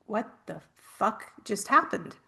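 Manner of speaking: shocked mutter. Retold or imitated. Shock after witnessing death.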